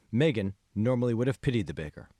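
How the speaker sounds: usual speaking voice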